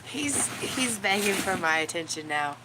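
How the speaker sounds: usual speaking voice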